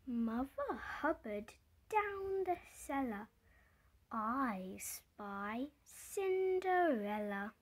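ordinary delivery, nothing marked

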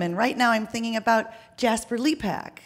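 normal talking voice

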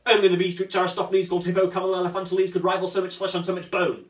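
very fast, show-off scholarly list; pedantic rattle. Acted. Cyrano plays a pedant, reeling off names very fast. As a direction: reel off the learned names at speed.

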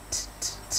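"T, t, t" as soft bursts of air; three separate unvoiced releases for "T, t, t"; voiceless T. Shown as a demonstration. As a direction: tap out "T, t, t" with no voice. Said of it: "nó là vô thanh".

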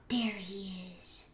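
soft whispered sighting; hushed hunter's murmur; stealthy whisper. Real, not acted. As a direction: whisper it so the prey won't hear. Spots a player while hunting.

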